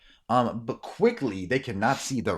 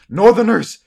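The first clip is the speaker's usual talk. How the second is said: loud, high cry of warning; alarm shout; 12 semitones higher, 14 dB louder than usual. Acted. Wounded boy raises the alarm.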